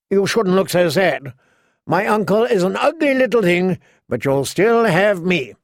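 put-on preening boy-king voice; cruel boy king, taunting. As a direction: needle her as a spoilt king. King Joffrey taunts Sansa.

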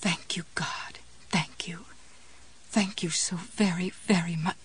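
soft, fervent whispered prayer; whispered thanks. A narrator's reading. Jessie thanking God as the cap turns, whispered. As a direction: breathe your thanks almost without voice.

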